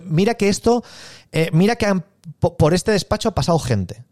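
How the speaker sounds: acted client testing how sure you are; challenging client. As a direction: needle the seller with a pointed challenge.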